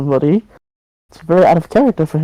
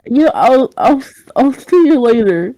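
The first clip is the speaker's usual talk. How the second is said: awkward exit; 8 semitones higher than usual. Acted. Awkward exit from captive's room.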